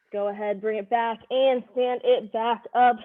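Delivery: ordinary talk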